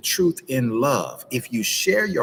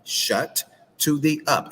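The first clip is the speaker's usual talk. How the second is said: slow, raised-pitch model phrase for repeating; drawn-out coaching; 5 semitones higher, slower than usual. Retold or imitated. Counsellor has both partners practice the exercise, slow drawn-out delivery. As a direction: stretch each word as a practice drill.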